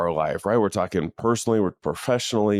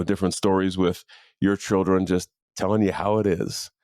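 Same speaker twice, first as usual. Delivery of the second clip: normal talking voice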